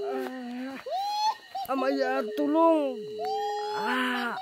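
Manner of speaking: high strained calls for help; high frightened calls to Amaya; desperate cry for help. Real, not acted. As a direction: call out desperately for rescue.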